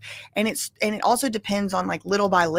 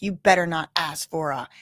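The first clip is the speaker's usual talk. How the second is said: lower, threatening man voice; 5 semitones lower than usual. Retold or imitated. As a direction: growl the warning, pitched low. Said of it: "He gave me these dirty looks".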